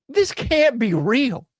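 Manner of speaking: usual speaking voice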